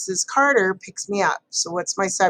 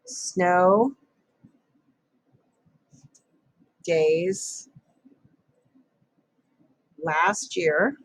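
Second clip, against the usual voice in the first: dictating; much slower than usual. Shown as a demonstration. Writing sentence word by word.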